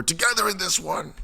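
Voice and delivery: high, strained rallying call; voice cracking. Real, not acted. Host telling followers we're in this together, voice cracking high. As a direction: push it up until the voice cracks. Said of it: "Man, my voice is cracked".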